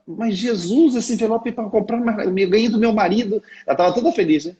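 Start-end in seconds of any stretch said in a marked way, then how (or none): 0.0-3.4 higher, whiny plea to Jesus; protest, not wanting to give away the gift; mock pleading protest.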